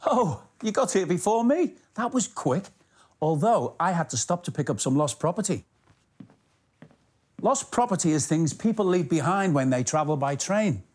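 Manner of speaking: ordinary delivery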